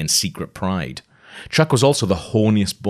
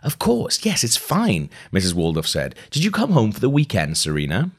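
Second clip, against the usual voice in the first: much higher, brittle fake cheer; forced polite hostess; 4 semitones higher than usual. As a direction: cover the shock with a chirpy yes. Voicing Mrs Waldorf's forced politeness.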